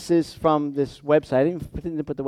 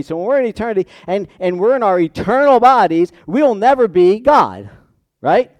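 raised, driving sermon delivery; higher-pitched pulpit insistence; emphatic preaching; 5 semitones higher, 4 dB louder than usual. Real, not acted. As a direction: preach it with force, hitting the key words. Preaches with rising force.

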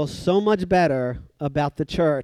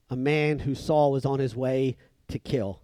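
usual speaking voice

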